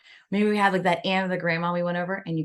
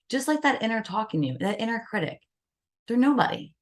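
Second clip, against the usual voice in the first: usual speaking voice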